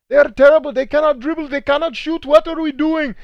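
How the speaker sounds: very high, loud mimicked Indian accent. Parent complaining about kids' basketball, high Indian-accented yelling. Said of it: "he was Indian. Um, that's how he sounded".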